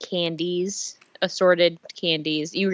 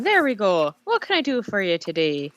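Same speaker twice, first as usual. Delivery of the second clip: put-on Irish accent. GM voicing the orc flower seller in an Irish accent. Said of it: "I see an Irish one".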